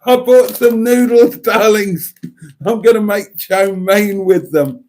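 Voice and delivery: high, loud gushing announcement; hammed-up excitement for adoring fans; all excitable. Telling darlings about noodles for chow mein, all excitable. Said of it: "I wanna get all excitable".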